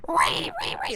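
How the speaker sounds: high squealing ree, like a pig; angry pig squeal. Retold or imitated. Kid squealing at the teacher, high angry pig squeal. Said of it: "like an angry pig".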